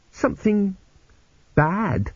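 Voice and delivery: high, halting, unsure answer; vague. A narrator's reading. Girl answers her rescuer's question, vague and halting. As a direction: trail it out vaguely with a pause. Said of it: "she said vaguely".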